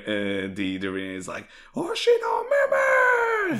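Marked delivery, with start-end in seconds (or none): 1.8-3.6 huge high yell at the sky; long desperate shout to the sky; shouting a name. Retold or imitated. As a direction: bellow the name upward, drawn out. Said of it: "Just shouts".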